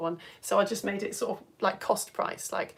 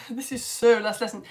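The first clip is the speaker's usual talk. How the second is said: ordinary talk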